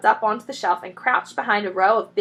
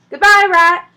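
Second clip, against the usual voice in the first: higher, loud cheeky farewell; calling out; 10 semitones higher, 13 dB louder than usual. A narrator's reading. The foxes call back to Rat as they escape, loud. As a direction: call it back over your shoulder. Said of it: "they called out".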